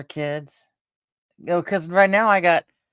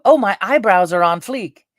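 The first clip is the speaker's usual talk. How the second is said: higher, louder trendy gush; mock trendy slang; 5 semitones higher, 8 dB louder than usual. Imagined person bragging about eyebrows, mock trendy gush. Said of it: "going up to somebody and go".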